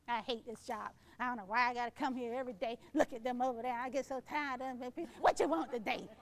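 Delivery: grumpy worker. Retold or imitated. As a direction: sulk like someone who hates the job. Voicing a negative coworker.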